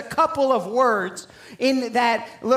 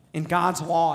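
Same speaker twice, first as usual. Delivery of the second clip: ordinary talk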